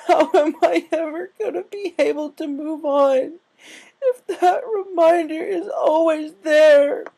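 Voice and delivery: high, loud, slow crying plea; sobbing. A narrator's reading. Rainbow sobbing her despair to Applejack. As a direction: sob the question out. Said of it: "she sobbed".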